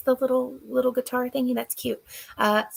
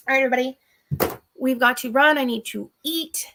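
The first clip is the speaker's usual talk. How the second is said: ordinary delivery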